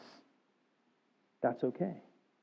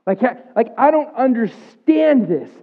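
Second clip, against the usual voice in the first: high, loud, pained protest; reenacting crying out to God; anguished cry to God; 9 semitones higher, 10 dB louder than usual. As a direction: relive the raw complaint after losing custody. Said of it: "And I remember saying".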